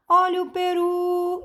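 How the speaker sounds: high, level hawker's cry, RU held; street vendor's call. Shown as a demonstration. Teacher testing peru with the selling trick, vendor's call. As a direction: sell peru with a long last syllable. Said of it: "é você vender a palavra".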